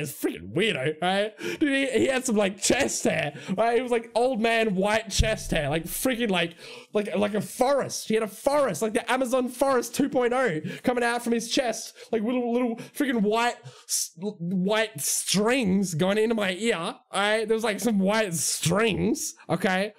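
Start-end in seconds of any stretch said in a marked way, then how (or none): none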